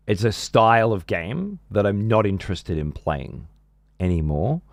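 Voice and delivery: slow, spaced-out delivery with long pauses. Real, not acted. Streamer explaining to a supposedly deaf viewer, deliberately slow. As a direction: spell it out slowly, phrase by phrase. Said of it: "So I'll speak slower".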